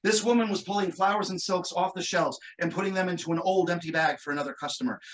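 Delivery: ordinary talk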